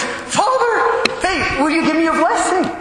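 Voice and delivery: very high, eager call for a blessing; excited request. Retold or imitated. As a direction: spin round and call out, delighted. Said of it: "turns all the way around and goes".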